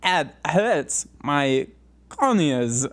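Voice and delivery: Christopher Walken. Acted. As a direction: complain of pain in broken-up phrases, hammed-up. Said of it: "Christopher Walken, but he's getting his eyes gouged out".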